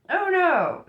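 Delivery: high, loud, hurried denial; hurried protest. A narrator's reading. Nun hastily correcting the new father, hurried protest. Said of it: "Sister Mary hurriedly".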